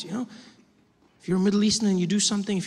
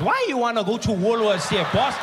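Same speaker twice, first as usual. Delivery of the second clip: nosy Indian GPS voice; curious questioning accent. Acted. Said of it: "no Indian GPS".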